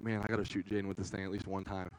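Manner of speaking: low, soft scheming thought; inner thought. Retold or imitated. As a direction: mutter the plan to yourself. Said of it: "I'm like".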